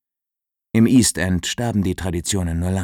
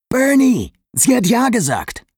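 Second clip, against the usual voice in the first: much higher, joyful call into the phone; elated, pitched way up; jubilant announcement; 4 dB louder than usual. A narrator's reading. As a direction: crow the news with delight. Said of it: "verkündete Danny jubelnd".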